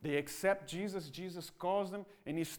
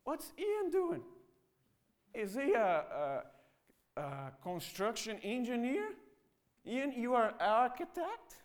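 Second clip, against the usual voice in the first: sarcastic mockery; 5 semitones higher than usual. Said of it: "Doesn't that happen to us?".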